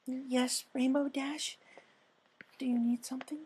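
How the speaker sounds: high timid pony asking kindly; gentle Fluttershy. Acted. Narrator voices Fluttershy.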